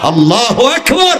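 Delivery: high, rising devotional cry; fervent takbir. Real, not acted. Preacher exclaims God's greatness to the crowd, high fervent cry. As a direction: send the exclamation soaring upward.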